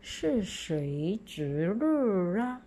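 是谁值日啊 slow, 啊 sounding as 啦; 是谁值日啊 read aloud. Shown as a demonstration. Teacher models example five slowly for pupils. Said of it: "例句五".

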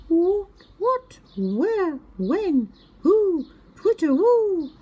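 high, excited chirping questions; bursting with curiosity, high and quick; small owl squeaking questions; little owl. A narrator's reading.

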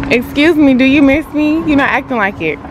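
sassy mock-hurt question to the pet; mock offended. Real, not acted. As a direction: playfully scold the dog. Scolding dog playfully.

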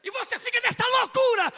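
loud, high, full-force preaching; fiery preaching. Acted. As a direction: preach it to the whole hall.

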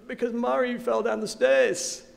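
hint of a crying three-year-old; tearful little boy.

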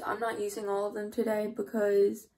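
ordinary delivery

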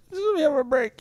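pleading for a break. Retold or imitated. Host begging callers to let him rest, tired plea. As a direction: beg for ten minutes' peace. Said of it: "take a break".

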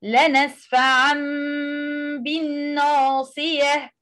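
لنسفعا بالناصية recited in tajweed style; Quranic recitation. Shown as a demonstration. Teacher reciting the verse from Al-Alaq as an example, tajweed style. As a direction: read لنسفعا with a clear ʕ and the tanween carried on. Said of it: "verse fifteen".

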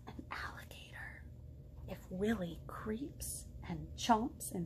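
sneaky whisper. Acted. Teacher whispering the swamp part to the children, hushed. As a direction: creep the words out in a hush.

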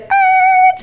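high squeaky rewinding tape sound; imitated rewind noise. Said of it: "My kids used to be like".

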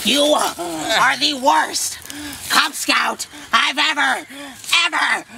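slow, emphatic angry shout; drawn-out, fed-up yell; exasperated scolding. Acted. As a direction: chew out a hopeless scout word by word. Scout leader berates his scout.

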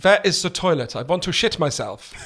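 imitating dad's thick Germanic accent; earnest dad with a Germanic accent; strong Germanic accent. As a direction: ask it in broken, Germanic-accented English. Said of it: "extremely strong Germanic accent".

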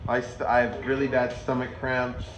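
ordinary talk

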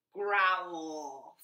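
playful polar bear growl. Acted. Librarian reads to kids, playful bear growl. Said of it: "says the polar bear".

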